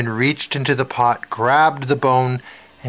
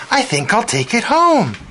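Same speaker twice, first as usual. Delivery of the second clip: pleased; 10 semitones higher, 4 dB louder than usual. A narrator's reading. Woman pleased with found bone.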